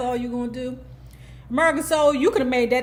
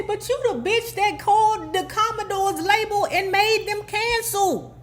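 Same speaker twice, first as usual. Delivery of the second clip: hammed-up high-pitched Gerald calling her out; Gerald accusing; 10 semitones higher than usual. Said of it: "Gerald say".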